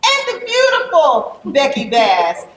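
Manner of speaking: high, loud, proud announcement; big emcee-style introduction; enthusiastic shout-out. Real, not acted. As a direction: hype up the performer, pitch and volume up. Hyping the musician who just performed.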